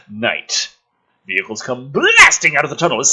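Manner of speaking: ordinary talk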